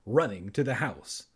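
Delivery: ordinary delivery, nothing marked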